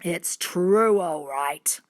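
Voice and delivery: low growled confirmation; deep, threatening grown-up voice; menacing man. A narrator's reading. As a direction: start loud, sink to a dark rasp.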